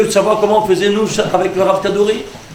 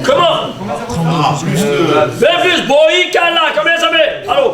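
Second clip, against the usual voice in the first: exasperated teacher; 7 semitones higher than usual. Real, not acted. Teacher presses a slow student.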